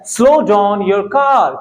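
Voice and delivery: each word laid out slowly and clearly; slow model sentence. Shown as a demonstration. Teacher slowly models a phrase for students. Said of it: "next आता है".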